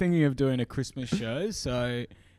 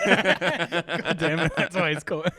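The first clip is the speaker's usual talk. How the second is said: laughing, slowed, louder; 4 semitones higher, 6 dB louder, slower than usual. Real, not acted. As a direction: talk through laughter. Laughing at his own joke.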